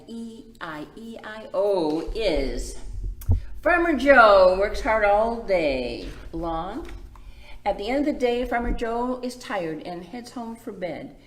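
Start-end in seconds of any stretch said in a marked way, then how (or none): 3.6-11.2 put-on reading to children. A narrator's reading. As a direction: lively, clear picture-book read. Reads picture book aloud.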